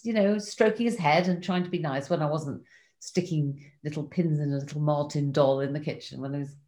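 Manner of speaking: ordinary talk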